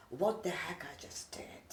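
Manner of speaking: breathy hushed whisper of despair; soft frightened whisper to Mom; retold whisper. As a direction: whisper it as if alone at night.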